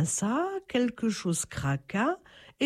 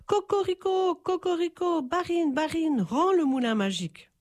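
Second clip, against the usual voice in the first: high crowing rooster call; rooster's crow; 6 semitones higher than usual. Acted. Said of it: "se mit à crier".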